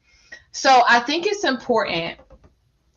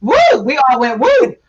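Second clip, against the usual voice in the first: excited; 6 semitones higher, 7 dB louder than usual. Real, not acted. Panel reacting to a tough question.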